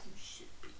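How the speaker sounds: hushed, pitiless imitation; unsympathetic murmur. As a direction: play him very softly, without sympathy. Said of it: "he has no sympathy for her".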